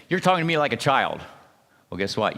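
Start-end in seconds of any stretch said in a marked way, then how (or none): 0.1-1.2 put-on louder, higher, petulant child snapping back; childish retort. Shown as a demonstration. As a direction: throw the line back like a sulky kid. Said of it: "You just talk like a child back".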